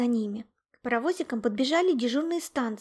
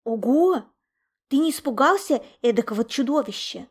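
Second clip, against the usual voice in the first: loud, wowed exclamation; impressed, wide-eyed astonishment; amazed gasp; 8 dB louder than usual. A narrator's reading. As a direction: gasp in awe at your friend. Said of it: "ахнул Лунчик".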